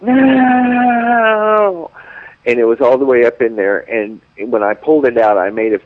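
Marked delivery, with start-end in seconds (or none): 0.0-1.9 long high drawn-out "No!"; mock cry of protest. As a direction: wail it out like he's in pain. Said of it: "And he's like".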